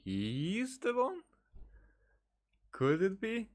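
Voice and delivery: high, slow, questioning disbelief; drawn-out surprised question; surprised realisation. Real, not acted. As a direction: wonder aloud, pitch climbing high on the question. Reacting to a plot reveal.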